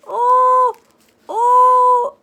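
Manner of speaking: high loud building oh, oh. Pretend. Reading to a child, high loud excited oh, oh. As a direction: gasp out the oh as the tension peaks. Stretching the slingshot.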